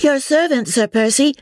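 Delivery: high-pitched, eager greeting; chorus of greeters. A narrator's reading. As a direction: call out a welcome from the crowd. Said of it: "heard in one long-continued chorus".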